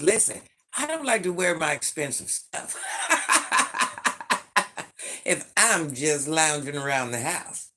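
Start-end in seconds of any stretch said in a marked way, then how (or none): 2.8-5.2 breathy high giggle; giggling about her pricey jammies; airy giggle. Real, not acted. Laughing about pajamas.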